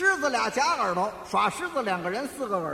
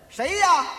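higher, louder questioning call; calling from inside; 6 semitones higher, 5 dB louder than usual. Acted. Man at home asks the night visitor, calling out. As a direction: call back to someone at the door. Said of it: "我在里边一问".

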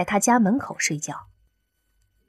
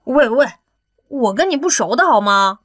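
exasperated, slightly raised protest; exasperated 刘亚楠; 4 semitones higher, 4 dB louder than usual. A narrator's reading. 刘亚楠 to an unwelcome acquaintance sniffing him, exasperated. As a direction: push them away with an annoyed objection. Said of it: "刘亚楠郁闷地直接说".

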